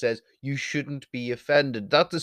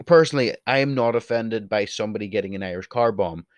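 ordinary talk

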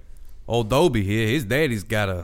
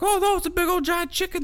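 much higher, squeaky mock panic; faster than usual. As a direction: shriek it like a cartoon crowd. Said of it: "everybody's like".

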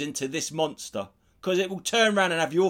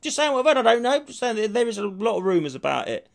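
innocent protest; 7 semitones higher, 3 dB louder, faster than usual. Retold or imitated. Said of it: "I went".

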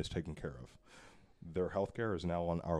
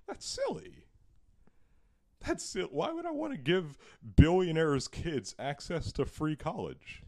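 high-pitched parody of a dismissive candidate; mock politician scoffing; 8 semitones higher than usual. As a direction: scoff in a silly, raised imitation voice. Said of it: "his main argument for attacking".

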